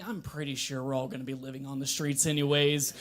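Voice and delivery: usual speaking voice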